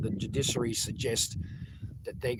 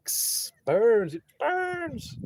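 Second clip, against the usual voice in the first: high, pained yelp at the sun's sting; sharp hiss then a squeaky complaint about the heat; burning sun; 12 semitones higher, 4 dB louder, much slower than usual. Real, not acted. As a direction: wince and squeal as the sun burns. Sun coming through, it bites.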